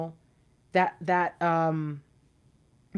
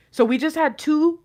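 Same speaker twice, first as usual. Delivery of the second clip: normal talking voice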